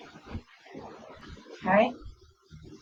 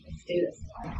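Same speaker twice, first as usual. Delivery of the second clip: whispered; 13 dB quieter than usual. Real, not acted. Quiet aside while gluing.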